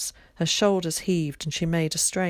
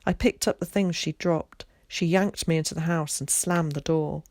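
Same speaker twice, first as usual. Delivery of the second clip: usual speaking voice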